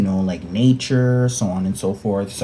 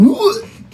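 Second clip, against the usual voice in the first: retching gasp; 5 dB louder than usual. Retold or imitated. Guy about to puke blood.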